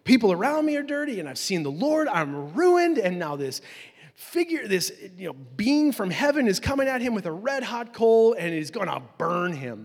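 loud, high-pitched acted panic; hammed-up frantic outburst; freaking out. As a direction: play a man in mounting alarm, easing off at the end. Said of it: "he's freaking out".

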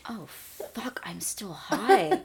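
whispered, slow dawning panic; shocked whisper. Retold or imitated. Realizing she is still high.

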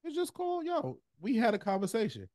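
squeaky high mocking tone. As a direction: imitate the dude lightly. High mock quote.

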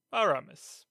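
ordinary delivery, nothing marked

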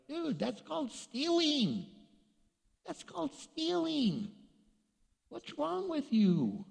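high-pitched, drawn-out reproach; incredulous scolding. Acted. Mock scolding of shoplifters.

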